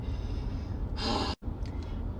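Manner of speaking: long exasperated sigh; heavy sigh. Real, not acted. Venting about an awkward date, heavy sigh. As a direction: let out a long, breathy sigh.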